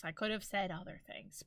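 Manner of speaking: ordinary delivery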